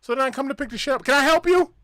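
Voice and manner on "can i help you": loud and high, shouting at a customer; mock loud shop clerk; loud tone-deaf guy. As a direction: bellow a friendly greeting too loud. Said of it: "Same thing all over again".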